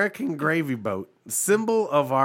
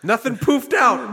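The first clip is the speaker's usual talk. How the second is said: mocking salesman; 10 semitones higher, 3 dB louder than usual. Genie seller scene.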